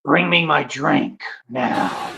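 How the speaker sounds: slow, put-on superhero voice; Batman. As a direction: draw out a commanding order. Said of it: "Why are you talking like that".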